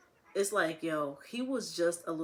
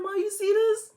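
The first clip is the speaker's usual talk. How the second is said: high, loud squeaky pet voice; dog's voice; 11 dB louder than usual. Retold or imitated. Mom voicing the dog whose food got eaten, high and squeaky. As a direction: voice the dog as an outraged little tattletale. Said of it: "Kush looking like".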